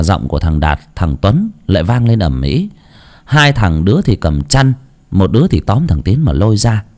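plain reading voice

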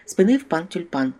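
ordinary delivery, nothing marked

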